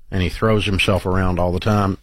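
ordinary talk

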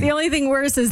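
usual speaking voice

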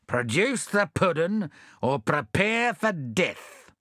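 stern threat. Acted. Bill threatens.

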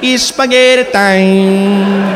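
put-on sleepy Italian accent, very slow; dreamy Italian accent. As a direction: drift off to sleep with it. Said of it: "Say that catchphrase to yourself as you drift off".

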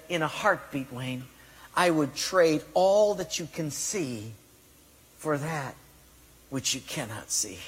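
retold tearful confession. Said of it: "tears in his eyes".

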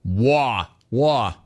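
mock New York accent, r dropped; lightly mocking New York vowels; Tony's New York accent. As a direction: imitate a New Yorker saying "war" as "wah". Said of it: "Bro, are you from New York?".

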